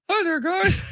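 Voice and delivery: very high puppet voice, lips barely moving; ventriloquist voice. Pretend. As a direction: talk like the dummy without moving your mouth. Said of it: "Imagine if he did a whole post game like".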